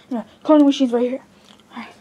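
ordinary delivery

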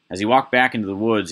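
usual speaking voice

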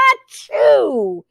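big falling achoo; pretend sneeze. A narrator's reading. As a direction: sneeze it out for the story. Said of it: "and the sneeze".

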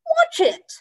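high, loud sharp cry; sudden warning. Acted. Gilly warning the stumbling old man, alarmed. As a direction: call out in alarm.

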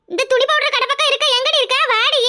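high, carrying call to friends. Acted. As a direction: holler for friends lost in a crowd. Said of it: "கத்தி கத்தி கூப்பிட்டுக்கிட்டு கிடந்த". (உயர்ந்த குரலில் கூவி அழைத்தல்)

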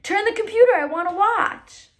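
high, loud eager command; excited soldier demanding to watch. Acted. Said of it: "The tank commander was excited".

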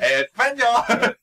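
loud, slow, high jeering shout; mock call. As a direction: call it out like a jeer. Mock exclamation.

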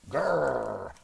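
rolling "grrr" of a charging dinosaur; dinosaur growl. A narrator's reading. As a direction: growl like a hungry beast. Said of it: "super hungry dinosaur charged in".

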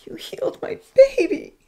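very high, loud tearful joy; happy sobbing. Acted. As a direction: cry out overjoyed as a grateful mother. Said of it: "the woman sobbed happily".

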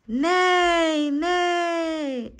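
high, drawn-out spoken neigh; horse's neigh. A narrator's reading. Narrator reveals the horse behind the stable, neighing high. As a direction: call out like a storybook horse.

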